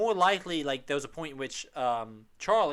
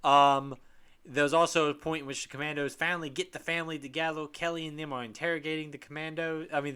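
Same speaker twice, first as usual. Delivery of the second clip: usual speaking voice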